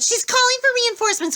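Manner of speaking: high, loud urgent warning. A narrator's reading. Annabeth warns friends of reinforcements, high and urgent. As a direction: warn them fast and tense.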